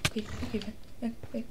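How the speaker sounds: soft, nervous okay-okay; whispered nervous retreat; hushed retreat. Real, not acted. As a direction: back off quietly.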